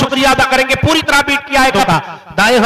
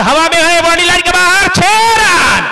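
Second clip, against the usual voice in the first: higher, louder build to a shout; excited cricket commentary; 6 semitones higher, 4 dB louder than usual. Real, not acted. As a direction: shout out the six. Ball over boundary for six.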